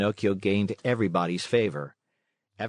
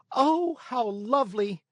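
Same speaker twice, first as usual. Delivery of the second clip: put-on high, delighted squeal of a puppet boy; joyful cry; 14 semitones higher than usual. A narrator's reading. Puppet boy learns the fairy will be his mother, high overjoyed cry. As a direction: burst out with joy as the young puppet. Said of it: "cried Pinocchio, jumping with joy".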